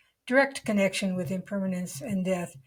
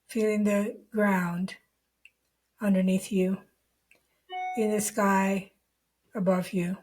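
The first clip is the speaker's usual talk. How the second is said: guided meditation. Real, not acted. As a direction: lead the listener unhurriedly into the body, leaving long silences.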